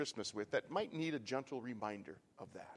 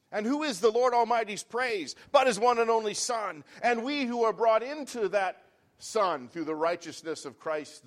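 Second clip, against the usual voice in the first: loud, high, ringing sermon delivery; full-force preaching; 6 semitones higher, 10 dB louder than usual. Acted. Preacher lifting up praise to the congregation, loud and ringing.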